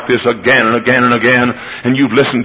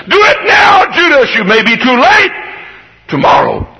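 high, loud shout; bully him into it now; shouting tempter; 12 semitones higher, 3 dB louder than usual. Acted. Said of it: "ping-pong ball going side to side".

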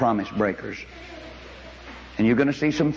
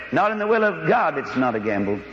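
ordinary delivery

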